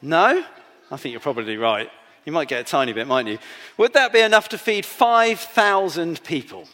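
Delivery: normal talking voice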